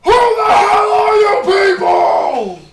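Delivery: high, slow bellowing yell from offstage; furious shout. Acted. Angry father bellows at strangers in his tavern, high slow shout. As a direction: scream it from the back room. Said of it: "from the back, you hear".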